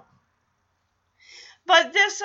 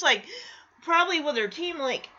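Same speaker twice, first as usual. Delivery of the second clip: ordinary talk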